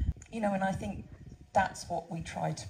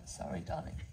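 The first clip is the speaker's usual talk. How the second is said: soft, quiet murmur to the horse in her arms; quiet tender aside to a horse; tender apology to horse; 7 dB quieter than usual. Real, not acted. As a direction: hush it gently to the animal. Apologising to the horse she cuddles.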